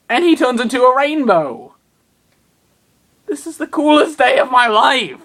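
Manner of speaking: overjoyed at the penguin exploding into rainbows; giddy excitement. Real, not acted.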